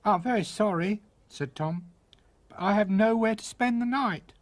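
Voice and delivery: put-on tiny boy voice. Tom voice.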